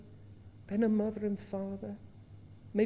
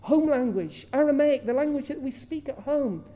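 higher, louder eyewitness voice, a touch of Peter; lightly voiced Peter recalling the scene; eager fisherman retelling what he saw; Peter remembering; 4 semitones higher, 6 dB louder than usual. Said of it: "Peter was to say".

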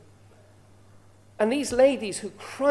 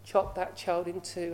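quiet, cold and hard, softer voice; cold-hearted; 3 semitones lower, 7 dB quieter than usual. Retold or imitated. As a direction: say it coldly, without feeling. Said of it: "the other lady was cold-hearted".

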